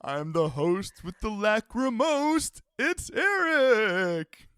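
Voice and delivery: high, loud showman's flourish; hammed-up announcer. Host announcing himself to listeners, big showy wail. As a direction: build to a long, drawn-out name.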